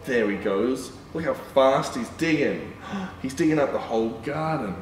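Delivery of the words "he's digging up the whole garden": usual speaking voice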